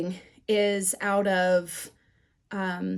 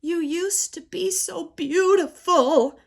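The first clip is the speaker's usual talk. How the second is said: high, slow tearful wail; mock crying, drawn out and high; hammed-up weepy lament; imitated tearful mother; 8 semitones higher, 3 dB louder, slower than usual. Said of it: "my mother ... bursts into tears".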